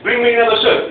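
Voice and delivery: loud, high, bossy order; curt demand. Retold or imitated. Husband ordering his wife for a fresh shirt, loud and high. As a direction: bark the order at her. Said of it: "I said".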